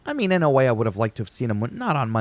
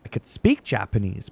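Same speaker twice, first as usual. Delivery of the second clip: normal talking voice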